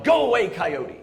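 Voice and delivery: mock yell. Reading the sign's yell.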